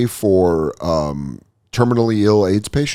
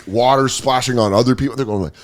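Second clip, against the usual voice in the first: ordinary talk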